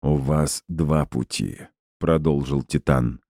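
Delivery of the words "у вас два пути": distorted robot voice. Acted. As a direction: speak like a damaged machine, lightly warped. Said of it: "низким и сильно искажённым голосом повреждённого робота".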